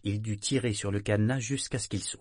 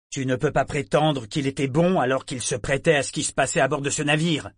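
stern rebuke; 4 semitones higher, 5 dB louder than usual. Acted. Saadar réprimande Yémin.

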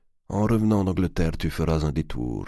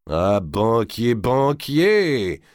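slow, loud, rising delight, grinning; delighted exclamation; 5 semitones higher, 4 dB louder, much slower than usual. A narrator's reading. D'Artois thanks the banker for the gold, grinning and loud. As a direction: beam at him as the money arrives. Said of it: "s'écria d'Artois avec un grand sourire".

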